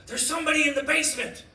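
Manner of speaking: high-pitched urgent alarm; alarmed wife. Acted. Wife wakes husband about an intruder, alarmed. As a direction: sound the alarm, pitch jumping up.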